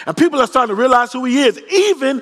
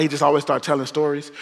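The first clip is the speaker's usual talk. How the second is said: ordinary talk, nothing marked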